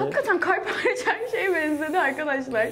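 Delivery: words broken by laughter; laughing while talking. Real, not acted. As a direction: laugh through the line. Laughing at her graffiti. (gülerek konuşma)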